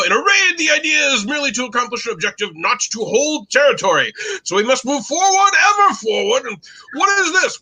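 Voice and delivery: high, commanding mock British officer accent; terrible British accent. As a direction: lecture the troops in plummy put-on British. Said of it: "I will do my terrible British accent".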